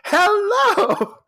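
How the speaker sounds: high, loud tipsy hello; mock tipsy hostess shouting hello; drunk sing-song hello. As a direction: sing out a hammered greeting. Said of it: "and she's like".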